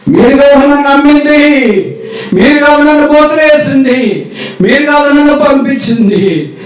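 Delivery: high, slow, loud proclamation; Joseph's passionate proclamation to his brothers; impassioned cry. Acted.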